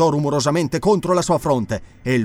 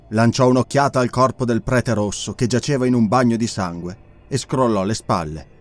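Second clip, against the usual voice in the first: ordinary delivery, nothing marked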